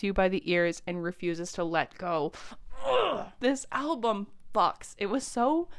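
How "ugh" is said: high, pleased groan of delight; excited ugh. Real, not acted. Music fan hyped about a great album, excited groan. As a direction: let out a thrilled ugh.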